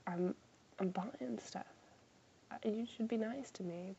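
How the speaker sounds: a mousy, barely-there reply; meek imitation. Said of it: "I just wanted to be like".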